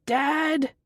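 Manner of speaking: put-on boy yelling for his dad; shouting. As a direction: shout as loud as you can. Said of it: "he shouts as loud as he can".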